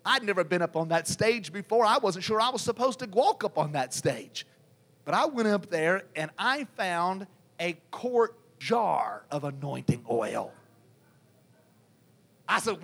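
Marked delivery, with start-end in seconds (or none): none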